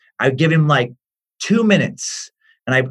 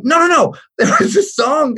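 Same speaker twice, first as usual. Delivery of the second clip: imitated panicked insistence; 11 semitones higher than usual. Acts out son insisting.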